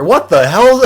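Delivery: loud, high outburst; exasperated disbelief. Real, not acted. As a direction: burst out, appalled. Exasperated at the faker's story.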